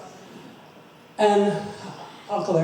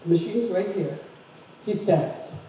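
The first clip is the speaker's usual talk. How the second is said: ordinary delivery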